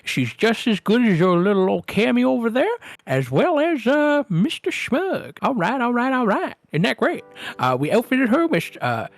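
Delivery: high, bustling little showman; squeaky, chummy gnome voice; excited gnome salesman. Acted. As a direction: pitch it high and eager, like a proud dealer. GM plays Jeb and Tuppence.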